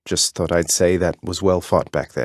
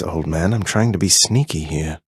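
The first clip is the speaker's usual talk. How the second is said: ordinary talk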